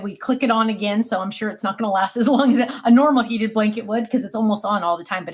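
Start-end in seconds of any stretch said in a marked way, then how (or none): none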